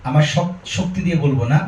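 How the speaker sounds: ordinary talk, nothing marked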